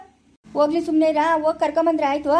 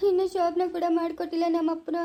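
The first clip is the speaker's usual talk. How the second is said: crying; 4 dB quieter than usual. Acted. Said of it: "ಅಳ್ಬೇಡ ಸುಮ್ನೆ ಇರಪ್ಪ".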